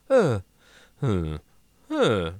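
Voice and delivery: short falling mute grunts; little noises in place of speech; wordless grunts. Retold or imitated. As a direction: imitate a character who can only grunt. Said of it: "'cause they can't say anything".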